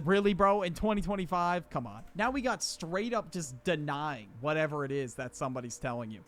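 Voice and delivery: ordinary delivery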